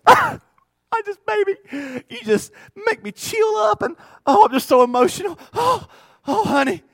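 camp it up like a sobbing talk-show guest; mushy goo-goo guy. Retold or imitated. Husband gushing on Oprah's couch, mock weepy and high. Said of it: "all goo-goo on the Oprah show".

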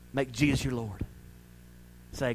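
usual speaking voice

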